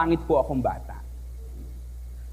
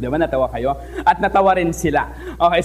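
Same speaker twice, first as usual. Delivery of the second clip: ordinary talk, nothing marked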